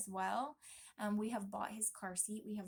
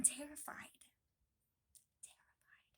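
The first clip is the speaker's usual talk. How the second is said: hushed, breathy confession of fear; whispered fear; 5 semitones higher, 11 dB quieter than usual. Real, not acted. Expectant mother admitting her fear of giving birth alone, whispered. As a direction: let the fear drop to a whisper.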